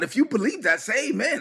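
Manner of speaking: lifted high, calling out to the congregation; rousing call for amen. Real, not acted. Preacher to the online congregation, a high rousing call. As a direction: pitch it up and pull the crowd in.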